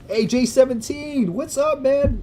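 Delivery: high, loud, eager shout-out to a viewer; excited greeting. Real, not acted. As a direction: greet him like a long-lost buddy.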